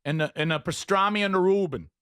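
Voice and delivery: hammed-up New Yorker; mock New York accent. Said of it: "You're talking to them this way?".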